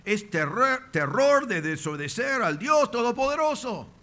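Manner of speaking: high, forceful cry of warning; emphatic preaching. Real, not acted. Preacher warning the congregation against disobeying God, emphatic cry. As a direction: lift the pitch high and drive it home. Preacher stresses the strong definition.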